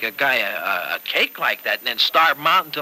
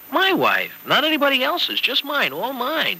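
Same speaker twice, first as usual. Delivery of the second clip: rising much higher into "just mine, all mine"; possessive delight; 11 semitones higher than usual. Acted. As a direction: build into an eager, loving claim. Husband tenderly claims his wife.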